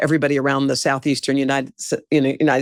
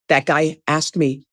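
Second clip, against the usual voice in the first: ordinary talk, nothing marked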